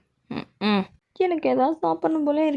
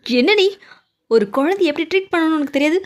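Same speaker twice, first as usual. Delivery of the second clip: angry scolding; 3 semitones higher, 5 dB louder than usual. Acted. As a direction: scold her for mistreating the child. குழந்தையை நடத்தியதற்கு கண்டிப்பு. (குழந்தையை நடத்தியதற்கு கோபமாக உரக்க திட்டுதல்)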